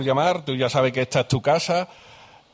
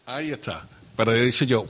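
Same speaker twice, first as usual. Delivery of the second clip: ordinary talk, nothing marked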